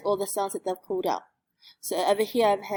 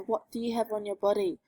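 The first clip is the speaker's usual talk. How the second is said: ordinary talk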